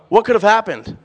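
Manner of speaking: usual speaking voice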